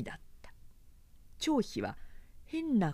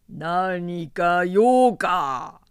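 slow menacing; 9 dB louder, much slower than usual. A narrator's reading. Zhang Fei faces the spears.

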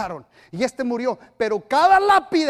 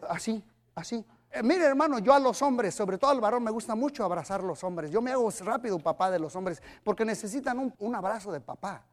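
ordinary delivery

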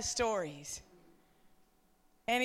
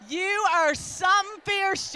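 calling out; 7 semitones higher, 5 dB louder than usual. Real, not acted. Calling out women by name.

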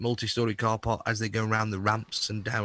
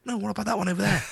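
lightly voiced wife, pitched up; a higher, mildly nagging mimicry; imitating the wife; 10 semitones higher, 4 dB louder, much faster than usual. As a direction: mimic an interfering passenger. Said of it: "so she'll go".

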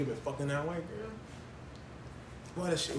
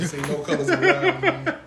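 laughing movie quote; 7 dB louder, slower than usual. Retold or imitated. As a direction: laugh through the line. Quoting movie scene laughing.

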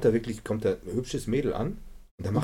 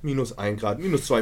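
ordinary talk, nothing marked

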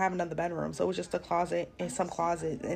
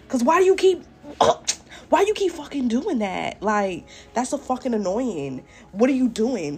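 angry yell; 5 semitones higher, 8 dB louder than usual. Retold or imitated. Retold yelling at the partner.

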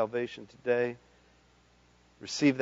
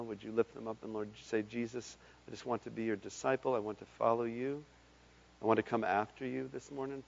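soft prayer; 7 dB quieter than usual. Acted. Quiet prayer led softly.

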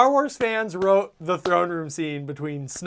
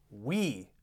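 soft reverent quote; 9 semitones lower, 13 dB quieter, slower than usual. Retold or imitated. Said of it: "that phrase".

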